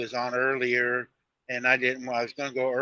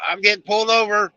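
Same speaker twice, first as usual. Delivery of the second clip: retold, raised and higher, hurrying off the phone; hurried raised voice; 7 semitones higher, 7 dB louder, faster than usual. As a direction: tell her fast and loud you're being pulled over. On phone with wife, being pulled over.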